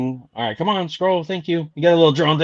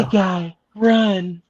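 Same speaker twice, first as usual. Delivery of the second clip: joking seductive lady warning; sexy lady voice; 3 semitones higher, much slower than usual. Acted. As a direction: lift it into a mock sexy lady. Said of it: "I'll do the sexy lady voice for you".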